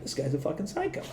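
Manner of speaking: hushed disbelief in a retelling; retold muttered aside. Retold stunned aside about colleague.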